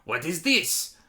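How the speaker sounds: flustered Frenchman bewildered by modern things; Napoleon. Retold or imitated. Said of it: "he spends the entire movie being like".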